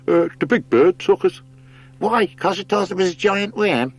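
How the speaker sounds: high, odd bird voice, defensive. Acted. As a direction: voice a simple, stubborn bird. Bird characters bicker.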